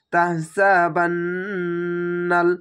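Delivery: slow, drawn-out tajweed chant; Quranic recitation. Shown as a demonstration. Teacher shows students the recitation, slow and drawn out. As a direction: stretch each vowel like a reciter. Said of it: "যেখানে টান থাকবে ওখানে শুধু এক আলিফ টেনে পড়বেন".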